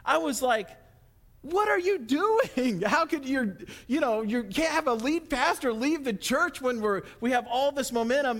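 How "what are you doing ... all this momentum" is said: distraught protest. Retold or imitated. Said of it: "And I was distraught".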